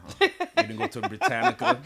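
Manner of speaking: laughing high as he teases her about the dictionary; amused teasing question, laughing. Real, not acted.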